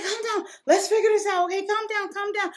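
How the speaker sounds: high, urgent imitation of mom; mother calming. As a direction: hush her quickly, mom's voice. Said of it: "she's like".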